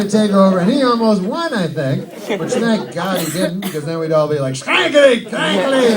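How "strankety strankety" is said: high mock German nonsense, hammed up; mock German gibberish. Comic imitating German gibberish to the crowd. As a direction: bark fake German sounds. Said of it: "we'd have to talk like that".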